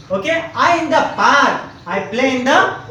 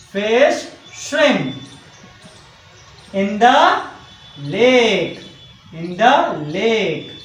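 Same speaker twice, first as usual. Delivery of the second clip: long pauses between short phrases; teacher spacing words out for writing; slow dictation; 3 semitones lower, much slower than usual. Shown as a demonstration. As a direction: dictate slowly, drawing out each word. Dictating corrected sentence while writing.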